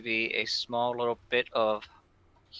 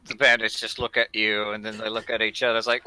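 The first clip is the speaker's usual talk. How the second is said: usual speaking voice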